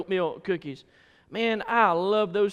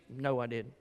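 meek denial; 7 semitones lower, 8 dB quieter than usual. Retold or imitated. Kid denying to mama.

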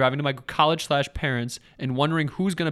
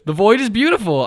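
excited; 7 semitones higher, 10 dB louder than usual. Acted. Ecstatic reading of a review.

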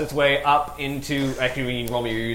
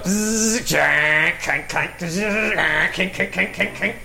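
machine clanking noises; 7 semitones higher, much slower than usual. Acted. Spider dismantling machine sounds.

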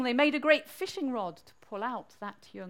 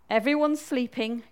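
plain reading voice

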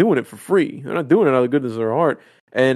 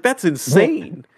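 stunned; 6 semitones higher, much slower than usual. Retold or imitated. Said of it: "I was like".

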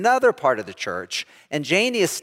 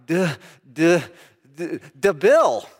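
mock stutter that finally lands on the name; stuck stammering; slower than usual. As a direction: get stuck on the first sound, then push the word out. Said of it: "And I'm like".

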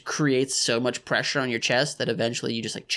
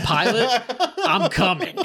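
action hero threat; 8 semitones higher than usual. Pretend. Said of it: "He was like".

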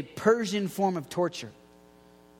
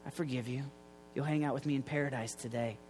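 tender Jesus; 7 semitones lower, 11 dB quieter, faster than usual. Retold or imitated. Jesus forgives thief on cross.

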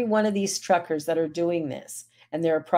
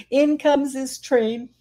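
slow and high, voice catching with real emotion; real tearful break, higher and slower; emotional, voice breaking; 7 semitones higher, 3 dB louder, slower than usual. Real, not acted. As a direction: let the words crack as tears come. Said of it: "I'm just doing this whole emotional thing tonight".

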